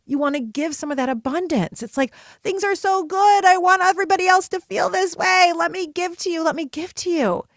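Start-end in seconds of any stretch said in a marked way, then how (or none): 2.4-7.4 high, gushing excitement; overflowing generosity. Acted. Voicing someone so full of goodness they must give, overflowing excitement. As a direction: gush as if bursting with abundance. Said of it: "you're overflowing".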